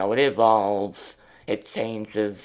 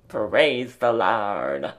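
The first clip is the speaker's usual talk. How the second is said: preacher voice; 5 dB louder, much slower than usual. Pretend. Mock preacher call.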